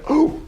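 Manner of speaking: high, sudden ooh of surprise; a high startled ooh on realizing he sounds like his dad. Real, not acted. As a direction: catch yourself with a sharp little ooh.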